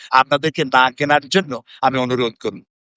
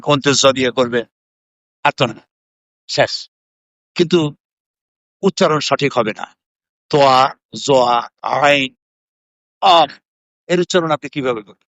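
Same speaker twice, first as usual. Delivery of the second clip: ordinary delivery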